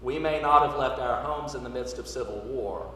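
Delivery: normal talking voice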